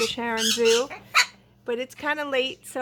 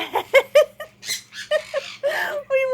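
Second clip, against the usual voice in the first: high laughing cry; 11 semitones higher than usual. Real, not acted. Laughing at parrot.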